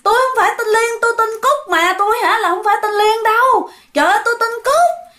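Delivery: high-pitched acted denial; crying out in protest. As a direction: wail the correction in mock panic. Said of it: "dì Cúc la lên".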